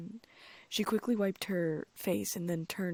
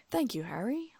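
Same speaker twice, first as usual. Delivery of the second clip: flattered, lightly voiced teacher; 6 semitones higher, 4 dB louder than usual. Lockhart flattered reply.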